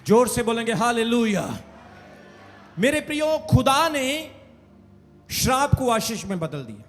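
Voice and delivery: ordinary talk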